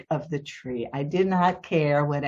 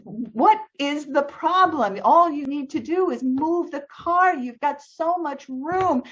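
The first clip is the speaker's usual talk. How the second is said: retold exasperation, pitched high; exasperated retort; 10 semitones higher than usual. Homeowner pleading with neighbor to move the car, exasperated. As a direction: argue back, high and incredulous. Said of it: "these were not the best responses".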